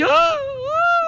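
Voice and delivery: high falsetto scream of a frightened fruit; mock scream. The frightened fruits shriek in the joke, high falsetto scream.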